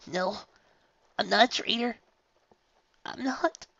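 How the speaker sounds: high, hushed broken denial; tearful mutter. Acted. Rainbow Dash denying, high hushed tearful mutter. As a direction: mutter through tears. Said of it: "she muttered".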